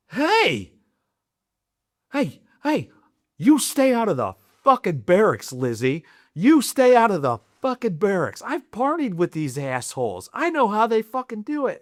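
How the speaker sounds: mock stern shout. Snapping out of tears to warn a dying loved one, mock-stern yell. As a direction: bark it like a protective buddy half-joking. Mock-warning her off the barracks.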